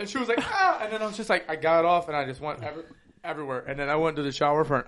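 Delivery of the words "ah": sharp high mock cry. Storyteller imitates the woman, high mock cry. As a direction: squeal it out short.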